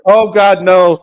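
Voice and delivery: loud, high cry of dismay. Real, not acted. Co-host reacting to Lindsay as teacher, loud dismay. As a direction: blurt it out over the others.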